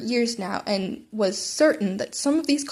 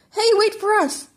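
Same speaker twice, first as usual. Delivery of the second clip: high, loud call; calling after; 11 semitones higher, 6 dB louder than usual. Acted. Classmates call after Izuku, high and loud. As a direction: shout it after someone running off.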